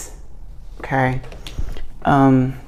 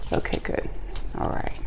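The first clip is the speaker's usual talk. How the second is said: muttered aside; 9 dB quieter, faster than usual. Real, not acted. Relieved aside while checking cards.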